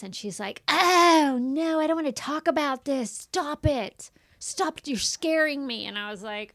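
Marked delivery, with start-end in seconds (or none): 0.7-5.8 higher, loud mock protest from a child; upset child's protest. Daughter begging mom to stop the period talk, upset protest. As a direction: imitate a kid refusing to hear it. Daughter refuses mother's pad lesson.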